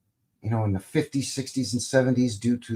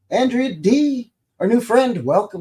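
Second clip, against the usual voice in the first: enthusiastic welcome; 11 semitones higher, 6 dB louder than usual. Real, not acted. Host welcomes new friend Andrea D, enthusiastic. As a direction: sing out a newcomer's name.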